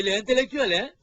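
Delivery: shouting praise over the talk; loud praise. Acted. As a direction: cheer him on loudly. Praising loudly over others. (éloge crié)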